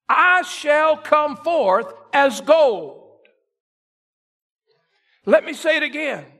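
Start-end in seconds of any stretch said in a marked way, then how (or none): 0.1-3.2 slow rising preaching. Acted. Climax of quoted verse.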